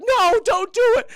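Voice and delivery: high, loud, drawn-out plea; desperate cry. Retold or imitated. Dog stopping the mob, desperate cry. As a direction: cry out to halt the attack.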